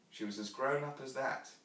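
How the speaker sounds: usual speaking voice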